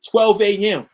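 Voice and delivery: imitated angry, triumphant jab; angry outburst. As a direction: throw it in his face, angrily. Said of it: "he's cursing at me".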